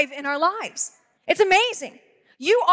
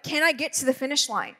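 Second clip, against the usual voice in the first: ordinary talk, nothing marked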